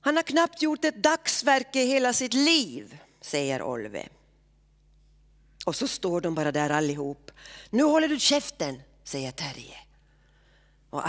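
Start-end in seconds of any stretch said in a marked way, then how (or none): 0.0-3.0 heated repeat. Acted. Olve repeats his insult, heated. 7.7-8.8 sharp angry order. Acted. Terje tells Olve to shut up.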